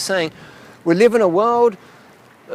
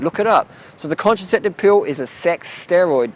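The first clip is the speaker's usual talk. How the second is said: usual speaking voice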